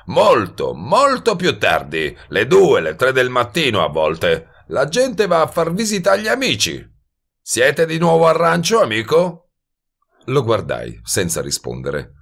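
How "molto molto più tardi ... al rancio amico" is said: high hustler stretching the first words; musing, needling wise guy. Acted. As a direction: draw out the musing, then needle him loudly. Voices Goebel musing, then needling. (sbruffone che rimugina e poi punzecchia)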